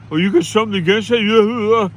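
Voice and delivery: loud, high, quick imitation of someone bristling; mock defensive retort. Man answering the phone challenges caller, mock indignant.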